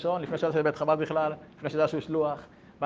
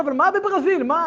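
very high, loud questioning; loud, baffled protest; acted bewilderment; 13 semitones higher, 8 dB louder than usual. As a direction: demand to know, voice jumping up.

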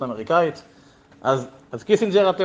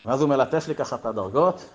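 normal talking voice

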